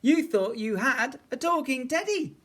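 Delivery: high, loud, gleeful taunt; teasing child. A narrator's reading. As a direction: crow it at the silly bear. Eddie teases the bear.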